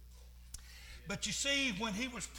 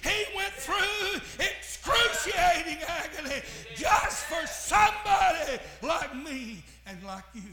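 full-force preaching; 6 semitones higher, 9 dB louder than usual. Acted. Preacher shouting to the congregation about the cross, full force. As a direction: preach it out over the organ.

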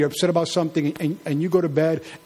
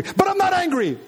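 shrill denial; 12 semitones higher than usual. Acted. Angry spouse denying anger in a mock quarrel. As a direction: insist you're not angry while plainly angry. Acted quarrel over yelling.

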